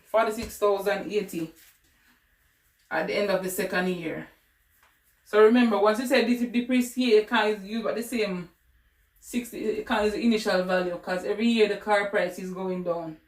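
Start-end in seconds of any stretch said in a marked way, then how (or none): none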